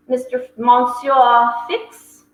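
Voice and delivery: usual speaking voice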